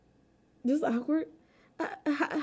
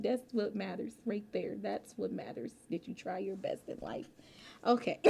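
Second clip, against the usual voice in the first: ordinary talk, nothing marked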